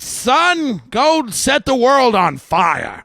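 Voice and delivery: high, slow, drawn-out proclamation; rousing parental send-off. Acted. Parent to son leaving at the airport, rousing send-off. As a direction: send the kid off grandly, stretching each word.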